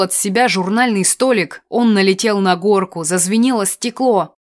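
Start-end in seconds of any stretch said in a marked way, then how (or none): none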